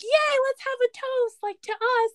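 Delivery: high, bubbly, giddy toast; gushing happy toast. Retold or imitated. Recapping a contestant toasting her date, giddy high voice. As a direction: squeal it out like she's over the moon. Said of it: "And she's so happy".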